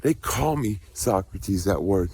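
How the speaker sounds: ordinary talk, nothing marked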